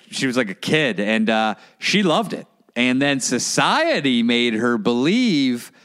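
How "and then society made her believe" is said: slow, drawn-out preachy delivery; measured, deliberate retelling of a speech; imitated guru speech. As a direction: stretch the key words like a lecturing guru. Quoting the cult leader's speech.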